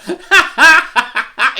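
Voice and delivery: loud high cackle; high laugh. Real, not acted. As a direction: crack up loudly. Laughing at guest's name joke.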